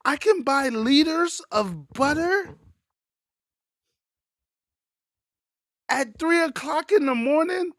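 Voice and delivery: high-pitched, wide-eyed tourist marveling at America; amazed foreign tourist. Retold or imitated. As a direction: play the tourist stunned by everything in the store. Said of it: "asking funny questions".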